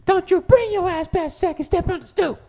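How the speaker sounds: very high, squeezed comic voice; Kevin Hart impression. Repeating the bit for a friend, squeaky warning. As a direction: squeal it like a stand-up punchline. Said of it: "anything by Kevin Hart".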